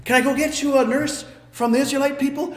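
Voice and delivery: put-on high bright little-girl offer; young girl's offer. A young girl offers Pharaoh's daughter a nurse, bright and high. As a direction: lift it high and sweet like a small girl. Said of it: "a young girl shows up".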